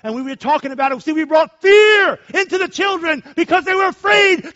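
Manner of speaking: loud, high preaching shout; fiery preaching. Real, not acted. Pastor preaching loudly to his congregation about consequences. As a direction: drive the warning home to the congregation.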